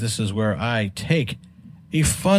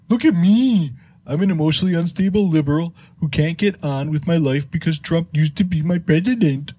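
higher cartoon dad voice, quick and whiny; doing Homer mocking a liberal tweet; Homer Simpson voice; 5 semitones higher, faster than usual. Reading the tweet as Homer Simpson, higher and quick. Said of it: "you have to do this in the voice".

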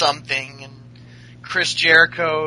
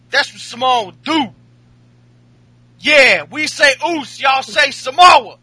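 hammed-up angry wrestler, much higher and louder; Jey Uso, pissed; 9 semitones higher, 6 dB louder than usual. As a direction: imitate an indignant wrestler telling someone off. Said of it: "like, pissed".

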